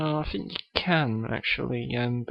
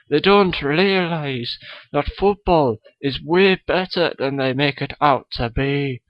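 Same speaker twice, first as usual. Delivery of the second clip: a kid's hammed-up Scottish accent; Billy Connolly impression; 3 dB louder than usual. Guest Billy Connolly greets the podcast host, mock Scottish accent. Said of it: "Billy Connolly is on the show".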